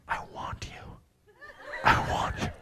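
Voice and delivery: breathy seductive whisper. Retold or imitated. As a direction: whisper it close and sexy. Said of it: "she whispered".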